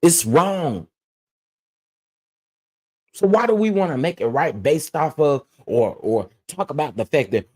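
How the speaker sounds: ordinary talk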